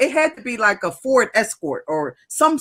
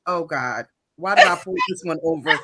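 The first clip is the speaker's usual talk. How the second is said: officer's mock regret; 6 semitones lower than usual. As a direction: imitate a weary cop regretting this stop. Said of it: "he looked like".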